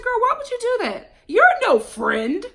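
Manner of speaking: high-pitched exasperated telling-off; sassy, outraged reproach; indignant scolding. Acted. Acted scolding of Caesar's client.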